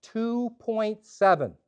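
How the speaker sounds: very slow, each word stretched out; cryptic pronouncement. Retold or imitated. Clerk sizing up the customer, slow cryptic verdict. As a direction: deliver the figure like a mysterious verdict. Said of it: "And he goes".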